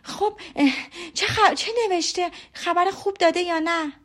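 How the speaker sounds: high, excited stammer; eager, impatient questioning. A narrator's reading. Daughter pressing papa about the letter, excited and impatient. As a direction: stumble over the question in high-pitched eagerness. Elizabeth presses father for news.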